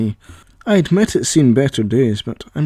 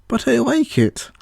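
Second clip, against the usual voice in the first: much higher, petulant; whiny; 12 semitones higher than usual. Acted. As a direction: act hurt and childish. AI voice teasing Paul.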